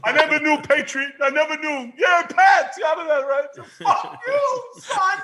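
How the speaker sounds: hammed-up fury at the son who switched teams; mock-furious dad shouting; mock yell at son. Dad yelling at traitor son.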